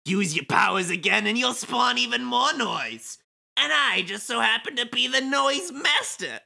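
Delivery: condescending explanation building to a high boast; sly villain Kubo. Acted. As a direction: spell it out smugly, then crow. Plays Kubo reading game script.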